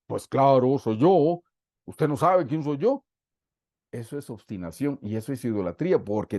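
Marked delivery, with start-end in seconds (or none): 0.1-3.0 mock arrogance. Voicing arrogant proud self.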